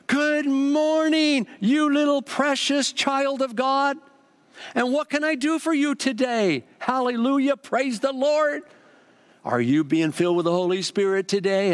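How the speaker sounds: high, slow, sugary sing-song greeting; gushing church lady. Retold or imitated. Bookstore lady greeting a customer, high and gushing. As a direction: coo like an overly sweet bookstore lady. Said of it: "this lady came out and says".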